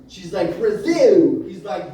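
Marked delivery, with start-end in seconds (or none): 0.6-1.4 loud, high, falling yell; indignant one-word holler; defiant shout. Retold or imitated. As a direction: belt out the country's name proudly. Woman shouts her country back.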